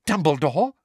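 eager high rising question; high eager schoolmistress asking. Acted. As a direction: pounce on the name eagerly. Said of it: "said Umbridge eagerly".

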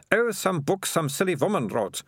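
high, offhand scorn; Melissa, dismissive girl. A narrator's reading. Melissa to Keith while picking the lock, dismissive. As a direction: brush it off in a high voice.

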